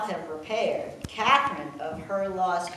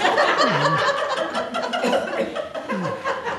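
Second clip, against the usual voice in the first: loud, high falling laugh; real belly laugh; high burst of laughter; 8 dB louder than usual. Real, not acted. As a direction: let the laugh break out high. Laughing over list of spouses.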